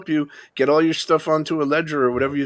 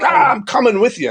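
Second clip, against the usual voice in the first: excited; 12 semitones higher, 5 dB louder than usual. Retold or imitated. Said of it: "And I'm like".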